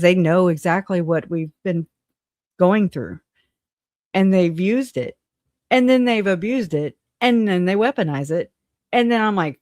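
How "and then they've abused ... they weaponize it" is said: ordinary delivery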